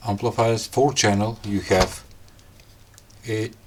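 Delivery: ordinary delivery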